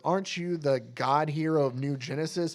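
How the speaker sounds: usual speaking voice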